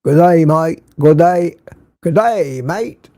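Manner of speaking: playful Aussie greeting; Australian accent. Pretend. Host greets Chris from Australia, Australian accent. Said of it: "a pretty good Australian accent without realizing it".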